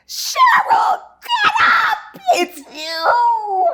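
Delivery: high shrieking call, half laughing, half crying; Quinta screaming. Retold or imitated. Castmate screaming at the winner to get up, ecstatic shriek. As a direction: scream it out in joyful disbelief. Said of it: "I hear Quinta screaming".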